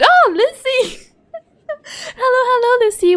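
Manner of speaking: high, loud, laughing hello; welcoming Lucy back to the stream with high laughing squeals; excited greeting. Real, not acted. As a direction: squeal her name with joy.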